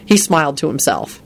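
usual speaking voice

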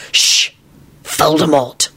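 understated grim reply. A narrator's reading. Lupin naming the enemy to Elizabeth, grim. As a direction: hush her, then name it grimly. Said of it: "Lupin replied grimly".

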